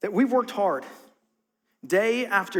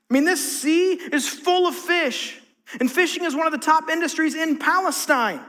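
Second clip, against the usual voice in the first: emphatic preaching; 5 semitones higher than usual. Acted. Preacher stresses the fishermen's fatigue, emphatic. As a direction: drive each line home to the congregation.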